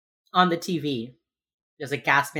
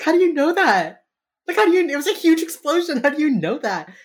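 high, loud, fast disbelieving questions; incredulous disbelief; 11 semitones higher, 8 dB louder than usual. Retold or imitated. Podcaster retelling her reaction to the TV, loud incredulous. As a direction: pile on the disbelief in a rush.

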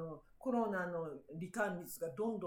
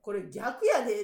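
slow, louder firm retort; firm correction; 8 dB louder, slower than usual. Retold or imitated. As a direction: set him straight slowly. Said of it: "って言ったら".

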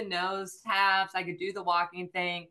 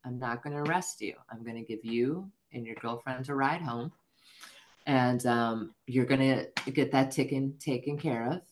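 lightly voiced officer, lower and measured; lenient deputy; 6 semitones lower than usual. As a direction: lay down terms in a low, calm voice. Retold deputy letting her go.

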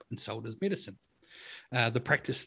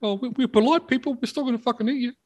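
high polite cannibal voice; 13 semitones higher, 6 dB louder than usual. Retold or imitated.

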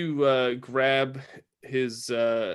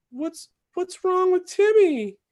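put-on very high, slow child's voice; little girl voice; 5 dB louder, slower than usual. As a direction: ask like a worried little girl. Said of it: "the little girl in the water says".

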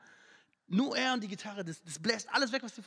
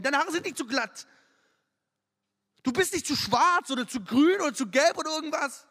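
loud preaching; 2 semitones higher, 7 dB louder than usual. Acted. Preacher lists body worries, loud.

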